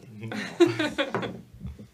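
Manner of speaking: high burst of laughter. Real, not acted. GM laughs at the answer.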